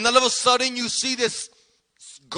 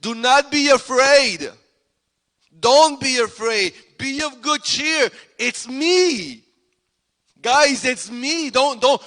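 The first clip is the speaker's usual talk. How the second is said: raised, higher voice calling across the water; yelling reassurance; 3 semitones higher, slower than usual. Acted. Jesus calls to frightened men in the boat, yelling. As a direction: call out to calm them, stretching the words. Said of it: "Jesus yells to them".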